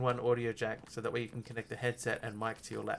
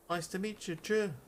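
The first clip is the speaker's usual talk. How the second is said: higher, light New Zealand accent; mock Kiwi accent; 6 semitones higher than usual. Shown as a demonstration. Still greeting the Kiwi viewer in a mock New Zealand accent. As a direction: keep up the playful Kiwi voice. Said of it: "My authentic New Zealand accent".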